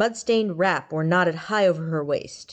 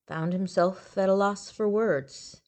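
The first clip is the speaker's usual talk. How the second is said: ordinary delivery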